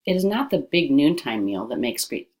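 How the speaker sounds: ordinary talk, nothing marked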